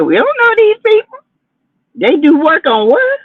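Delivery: a high, puzzled mock-local voice; imitating Huntsville locals. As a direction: act out a bewildered townsperson.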